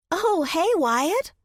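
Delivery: best friend cheerfully greeting a familiar customer; gleeful exclamation. A narrator's reading. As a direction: call out his name in bright delight. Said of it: "she exclaimed with glee".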